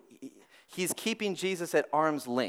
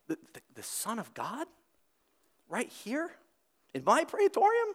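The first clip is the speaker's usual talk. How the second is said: squeaky mock disbelief climbing much higher; incredulous Pilate; 9 semitones higher than usual. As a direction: ham up Pilate gasping at the idea.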